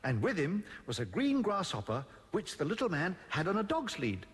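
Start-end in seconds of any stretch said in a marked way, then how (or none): none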